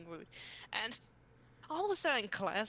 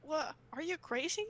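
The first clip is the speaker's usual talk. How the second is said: mock disbelief; 8 semitones higher than usual. Retold disbelief.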